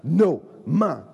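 loud, emphatic "No", then "mine"; possessive toddler. Retold or imitated. Nursery baby when another picks up a toy, possessive protest. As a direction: punch "No, mine" like a grabby child. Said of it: "First baby's like".

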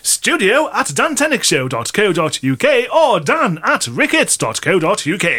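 plummy posh announcement with swooping tune. Pretend. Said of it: "if that was too posh for you".

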